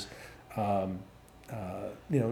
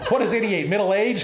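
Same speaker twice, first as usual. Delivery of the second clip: laughing tease; 8 semitones higher, 5 dB louder than usual. Retold or imitated. Teasing eighty-eight-year-old friend.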